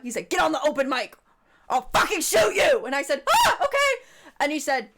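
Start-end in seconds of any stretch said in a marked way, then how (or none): 0.3-2.8 louder, higher mock threat; menacing threat. Armed man threatening narrator. 3.3-4.1 far higher, louder scared squeak; panicked yelp. Retold or imitated. Terrified reply to gunman.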